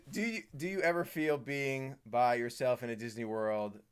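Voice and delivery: ordinary talk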